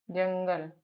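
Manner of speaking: usual speaking voice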